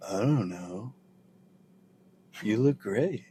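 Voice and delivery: lazy mumbling rock star. Retold or imitated. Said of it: "Was that a [name] impression?".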